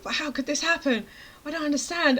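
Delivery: high-pitched baffled wail; retold incredulous protest; bewildered dismay. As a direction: puzzle over it in mock distress. Retold bewilderment at failed cake.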